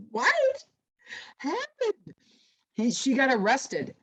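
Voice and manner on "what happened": very high-pitched, drawn-out squeal of disbelief; shocked. Retold or imitated. Retelling hearing mom is in jail, shocked. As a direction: squeak it out, stunned, in mock shock. Shocked reply to mom's arrest news.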